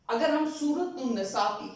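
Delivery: normal talking voice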